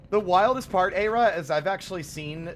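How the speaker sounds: excited. Real, not acted. Excited to tell.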